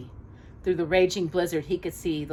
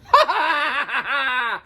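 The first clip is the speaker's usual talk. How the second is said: high hammed-up evil cackle; triumphant villain laugh; 8 semitones higher, 4 dB louder than usual. Jafar laughs in triumph over his enemies, evil cackle. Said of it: "a deep triumph laugh".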